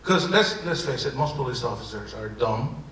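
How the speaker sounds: ordinary talk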